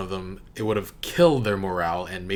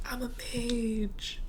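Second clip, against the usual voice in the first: tiny, high, drawn-out squeal; excited exclamation held down very soft; hushed mock cheer; 7 dB quieter, much slower than usual. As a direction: whoop softly in a high little voice. Repeats the scream after being shushed.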